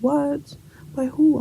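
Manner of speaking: high-pitched, incredulous questions; shocked friend. Retold or imitated. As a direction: imitate a friend's stunned reaction. Best friend reacts to exposure news.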